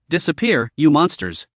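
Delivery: plain reading voice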